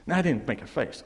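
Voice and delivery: ordinary delivery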